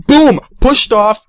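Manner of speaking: loud, high burst of mock impact; explosive boom. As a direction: punch it out like a sudden shove. Acting out the sudden shove.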